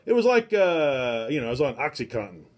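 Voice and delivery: usual speaking voice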